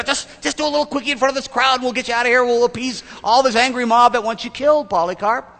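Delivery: high, loud, hurried coaxing; loud, high-pitched mock persuasion; coaxing official. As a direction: play an official pushing for a quick favor. Acts out officials coaxing old Polycarp.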